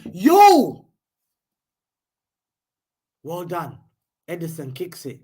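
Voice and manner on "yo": very loud, high yell; shocked yell; startled shout. Real, not acted. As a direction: cry out at the near chance. Near miss, sudden shout.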